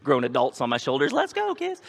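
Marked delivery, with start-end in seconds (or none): 1.1-1.8 mock dad cheer. Dad with grown kids on shoulders.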